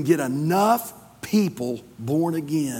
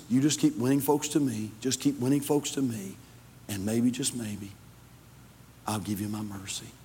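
soft, low reassurance; quiet, tender promise; gentle voice of God; 4 semitones lower, 6 dB quieter than usual. Acted. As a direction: let it sink softer and lower to the end.

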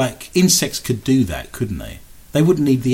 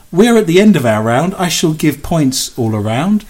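usual speaking voice

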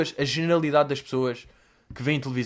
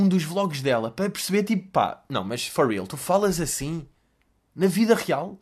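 ordinary talk

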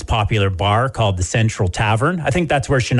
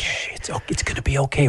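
hushed comforting whisper; playful consoling whisper; soothing whisper; 3 semitones higher, 5 dB quieter, faster than usual. Pretend. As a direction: shush them gently.

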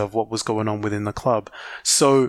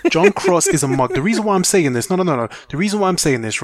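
louder and higher, worked up; heated rant; 6 semitones higher, 3 dB louder than usual. Real, not acted. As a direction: let the anger rise. Heated rant about journalist.

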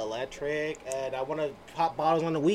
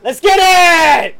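hype shout; 12 semitones higher, 14 dB louder, much slower than usual. Pretend. Mock church call for amens.

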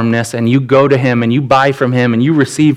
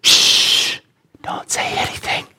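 whisper; 5 dB quieter, slower than usual. Retold or imitated. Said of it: "They're like".

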